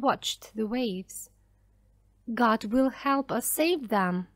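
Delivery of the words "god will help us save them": plain reading voice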